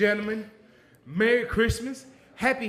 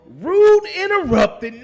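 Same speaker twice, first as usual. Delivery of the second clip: ordinary delivery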